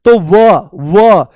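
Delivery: व stretched very slowly to reveal ऊ; slow teaching demo of व. Shown as a demonstration. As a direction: draw out व so the ऊ is heard. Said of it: "जैसे ही व बोलते हो".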